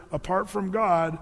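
ordinary talk, nothing marked

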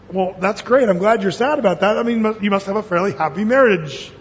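ordinary talk, nothing marked

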